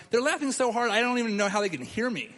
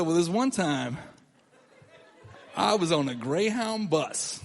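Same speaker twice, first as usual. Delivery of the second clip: slow storyteller opening; slower than usual. Retold or imitated. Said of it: "as I started to tell the story".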